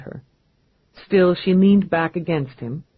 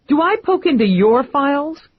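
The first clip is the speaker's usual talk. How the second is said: loud, much higher indignant challenge; demanding; 10 semitones higher, 4 dB louder than usual. Acted. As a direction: jab each word at him. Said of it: "she demanded".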